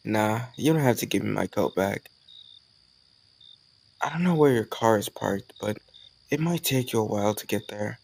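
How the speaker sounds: ordinary delivery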